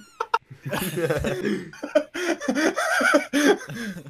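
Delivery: wheezing laugh after farting. Real, not acted. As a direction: laugh hard and high.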